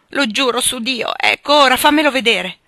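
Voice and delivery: loud, high, frantic oath; agitated, forceful pleading at full voice; desperate insistence. Acted. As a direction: swear it and demand your way. Father swears and demands his son.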